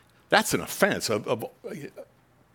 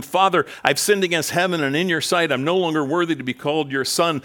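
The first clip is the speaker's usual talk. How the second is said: son's plea, higher and louder; pleading son; 6 semitones higher, 5 dB louder than usual. Acted. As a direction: beg forgiveness, raised up. Son's confession voiced.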